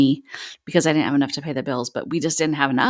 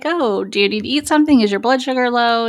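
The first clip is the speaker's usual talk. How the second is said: sister's concern voiced higher and a bit louder; lightly playing the worried sister, pitched up; 8 semitones higher, 3 dB louder than usual. Sister asks her panicking sibling on the hike, light concerned voice. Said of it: "her going like".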